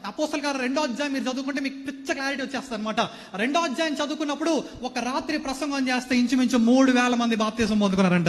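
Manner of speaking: ordinary delivery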